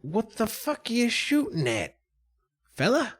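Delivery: high, drawn-in character voice; high-pitched, indignant figure; Don John. Acted. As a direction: question a companion in a high put-on voice. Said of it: "Don John will say".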